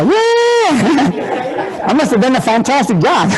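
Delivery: high whoop into quick laughing brag; laughing self-mockery. Real, not acted. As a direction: cheer yourself ironically, laughing. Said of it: "no questions, jokes".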